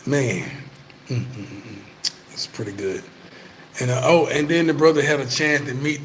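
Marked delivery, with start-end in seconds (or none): 1.1-1.8 savoring hum. Real, not acted. Savoring the Haitian meal.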